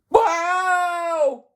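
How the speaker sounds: long, very high, loud howl; piercing, drawn-out yelp; yell of pain. A narrator's reading. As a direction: wail as the blow lands. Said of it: "yelled Yeats Thwaites".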